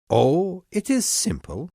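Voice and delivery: high-pitched, feigned ease; lying breezily. A narrator's reading. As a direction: make it sound simple, as a lying king. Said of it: "lied the king".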